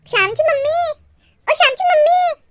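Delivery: sing-out call in a high voice. Acted. Girl calls to Shyam's mother, calling out. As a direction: call out for attention, pitched high.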